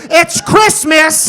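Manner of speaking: high, slow, loud proclamation; clueless camcorder announcer. Retold or imitated. Relative on old VHS, stilted shouting. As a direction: drawl it loudly, stating the obvious. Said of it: "They don't know how to act".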